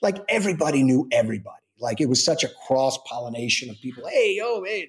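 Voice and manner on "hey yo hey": higher-pitched hailing of friends; mock greeting. Cast members greeting one another in the nineties, mock hailing. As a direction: imitate calling out to familiar faces. Acting out people greeting each other.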